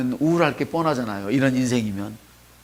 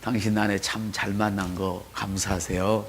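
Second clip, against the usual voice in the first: softly and slowly, lower, a first run-through of 당신 아내 참 잘 만난 거 감사하세요; quiet slow reading; 5 semitones lower, 6 dB quieter, slower than usual. Shown as a demonstration. Pastor models the line for the congregation, quiet and slow. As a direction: murmur it gently before the real go. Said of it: "우리 옆 사람한테".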